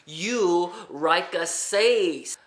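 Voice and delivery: bellboy saying it very slowly; slow, deliberate mock-accent repeat; drawn-out, spelled-out slow. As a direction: stretch every word out so he finally gets it. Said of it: "and so finally he goes".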